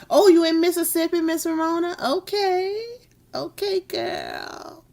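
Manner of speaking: high, fast, delighted greeting; excited. Real, not acted. Streamer greets a viewer from Mississippi, excited and high. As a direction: squeal hello to her.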